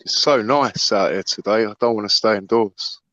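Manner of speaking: low pitched and down; depressed. Real, not acted. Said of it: "I can hear the depression in his voice".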